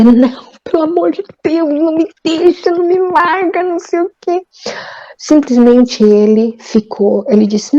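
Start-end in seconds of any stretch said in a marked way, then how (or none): 0.0-0.5 pleading woman. Retold or imitated. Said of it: "eles querem que a mulher diga". 0.6-4.4 mock pleading woman, pitched much higher. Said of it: "eles querem que a mulher diga".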